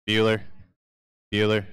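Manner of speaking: level monotone, each name left hanging; droning teacher calling an absent pupil; deadpan roll call. Acted. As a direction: call the name evenly into silence. Quoting the film teacher's roll call.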